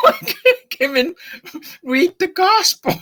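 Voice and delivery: laughing through the words, pitch shooting up; incredulous laughing protest. Retold or imitated. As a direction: giggle out the protest, high and breathless with laughter. Laughing at own disbelief.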